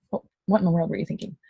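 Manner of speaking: fast mock-stern scold; a hint of a stern boss. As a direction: pretend to tell them off. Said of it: "I'm just kidding".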